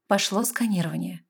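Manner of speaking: usual speaking voice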